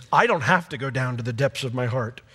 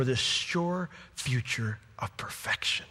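hushed; 3 semitones lower, 7 dB quieter than usual. Real, not acted.

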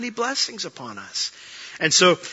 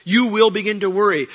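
ordinary delivery

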